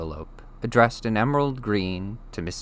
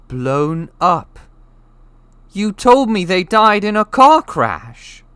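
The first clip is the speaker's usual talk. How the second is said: much higher and louder, stunned; shocked outcry; 12 semitones higher, 13 dB louder, slower than usual. A narrator's reading. Harry confronting the Dursleys about his parents' death, shocked. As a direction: demand the truth in disbelief.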